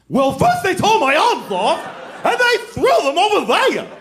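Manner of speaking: wildly over-the-top, high, wailing impression; Ray Bolger's Scarecrow. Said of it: "this performance that's way over the top".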